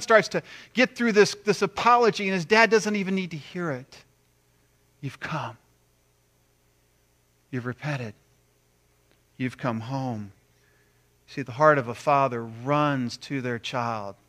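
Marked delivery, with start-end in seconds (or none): 5.0-10.3 soft father's reassurance; tender welcome. Acted. Father receives his repentant son, softly and tenderly. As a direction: say it softly, with long pauses between phrases.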